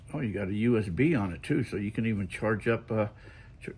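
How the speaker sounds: usual speaking voice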